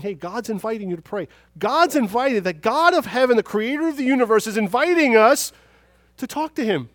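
building to high, very loud proclamation; impassioned preaching. Real, not acted. Preacher telling the congregation God invites them to pray, impassioned. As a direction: swell to a full-force pulpit climax.

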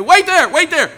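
high, loud acted holler; urgent phone shout. Preacher telling caller to hold on, shouting urgently.

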